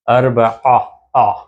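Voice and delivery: आ pushed from the throat; Arabic ع sound from the throat; throat sound ain. Shown as a demonstration. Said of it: "आइन की आवाज़ हलक से निकालते हैं".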